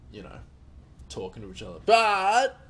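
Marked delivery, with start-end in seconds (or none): 1.8-2.6 sudden loud, higher burst; an accidental loud outburst; too loud. Real, not acted. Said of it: "sorry, that was a bit loud".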